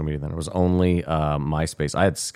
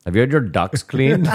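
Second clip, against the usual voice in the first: put-on high, laughing Indian accent sales pitch; Indian accent telemarketer; 7 semitones higher, 5 dB louder than usual. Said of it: "do it with an Indian accent".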